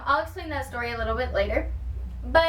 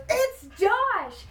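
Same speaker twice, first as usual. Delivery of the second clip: excited; 8 semitones higher than usual. Retold or imitated. Friend spotting the drummer.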